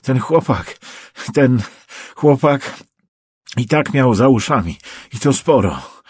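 halting, high-pitched self-justification; nervous babbling. A narrator's reading. Trapped prosecutor justifying himself to his captor, babbling nervously. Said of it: "bełkotał nerwowo".

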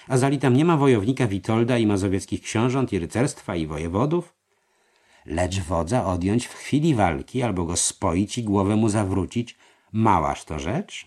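voiced treasurer. A narrator's reading. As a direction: coax him into agreeing. Merheim argues. (podskarbi przekonuje mistrza)

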